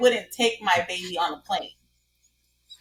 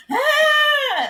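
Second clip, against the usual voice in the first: sudden high mock child's yelp, louder; mock child cry; 5 dB louder than usual. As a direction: imitate a pinched toddler's shriek. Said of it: "she'd be like".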